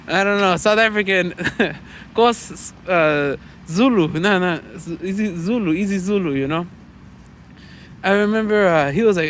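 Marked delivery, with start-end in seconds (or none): none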